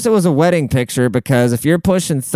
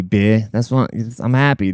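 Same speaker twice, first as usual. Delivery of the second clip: ordinary talk, nothing marked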